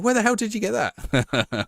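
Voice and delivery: imitating a Canadian guy, high and slow; Canadian accent. Canadian fan questioning the streamer's shirt, in a Canadian accent. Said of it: "he said it in a Canadian voice".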